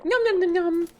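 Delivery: high squeaky nom sounds; playful high munching; squeaky chomping. Pretend.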